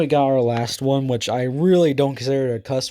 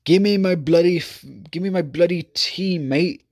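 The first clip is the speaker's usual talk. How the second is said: mock British accent; 5 semitones higher than usual. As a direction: ham up a British accent, pitched up. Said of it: "I need a British accent".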